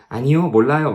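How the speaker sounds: bright, carefree "no, I don't know"; innocent and cheerful. Retold or imitated. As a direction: answer like a naive child. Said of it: "이렇게 해맑게 얘기할 수 있습니까?".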